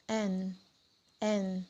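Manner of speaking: N said softly as "en"; N sound. Shown as a demonstration. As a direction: say N softly, teeth opening then closing. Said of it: "gigi awalnya dibuka lalu ditautkan". (bunyi N pelan, gigi dibuka lalu ditautkan)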